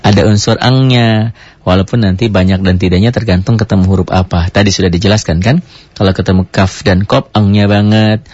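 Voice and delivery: ordinary talk, nothing marked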